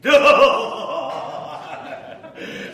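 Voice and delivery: high, loud villain laugh; evil laugh. Acted. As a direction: cackle like a gleeful vampire. Vampire laughs menacingly.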